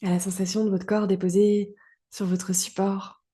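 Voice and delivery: ordinary talk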